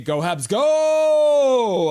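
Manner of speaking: long, high, loud fan cheer; cheering shout. Acted. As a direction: belt out a drawn-out team chant. Cheering for the Habs.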